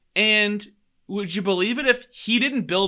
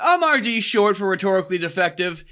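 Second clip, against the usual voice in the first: loud, swooping podcast opener; show announcer intro; 5 semitones higher, 5 dB louder than usual. Acted. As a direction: belt out the welcome like a hype announcer. Performs the show's opening.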